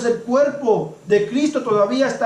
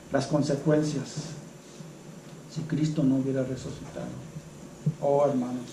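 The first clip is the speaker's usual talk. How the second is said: hushed gravity; 9 semitones lower, 11 dB quieter than usual. Acted. Preacher hushes on grave consequences.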